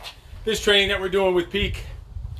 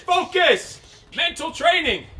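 pumped-up encouragement; 10 semitones higher, slower than usual. Real, not acted. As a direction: fire up the class. Coach pumping up class.

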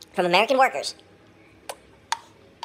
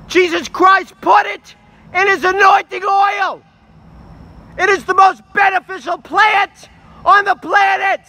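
much higher, much louder, slow shouting; protest shout; 11 semitones higher, 9 dB louder than usual. Real, not acted. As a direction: rant it like a street protester.

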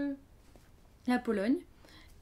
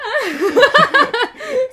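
loud, high-pitched real laugh; burst of laughter; 17 dB louder than usual. Real, not acted. Reacts to co-host's joke. (grand éclat de rire aigu)

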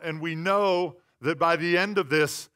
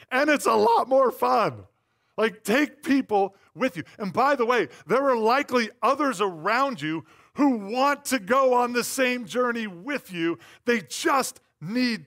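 loud, higher, driving sermon; full-force preaching; 7 semitones higher, 8 dB louder than usual. Acted. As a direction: preach it out to the congregation.

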